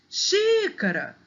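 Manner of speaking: XÍCARA with a very high, long XÍ; calling the word. Shown as a demonstration. Teacher showing first-syllable stress, calling XÍÍcara. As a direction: call out xícara, stretching the first XÍ. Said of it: "o xi é mais forte".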